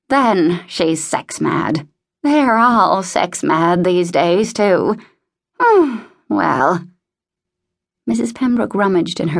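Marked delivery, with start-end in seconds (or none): 0.1-6.8 put-on slow, emphatic disapproving old lady; scandalised old woman. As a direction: drag out each accusation, then sigh. Voices scandalised Mrs Pembroke.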